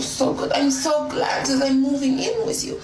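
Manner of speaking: high-pitched, hammed-up French accent. Woman arriving at her date's house with luggage, hammed-up French accent. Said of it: "I spoke in a French accent".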